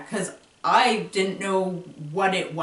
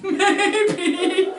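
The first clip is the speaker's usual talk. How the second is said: high maybe laughed out; louder, higher maybe broken by a laugh; 10 semitones higher, 5 dB louder than usual. Real, not acted. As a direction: giggle the word through a laugh. Laughing at own idea.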